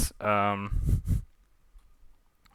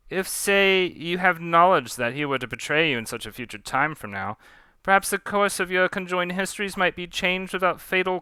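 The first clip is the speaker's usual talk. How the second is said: higher, pleading officer's voice; deferential crewman Mister Graves; 6 semitones higher than usual. Acted. Graves begging the captain to spare Pontou, deferential. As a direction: plead politely with your captain, pitched up.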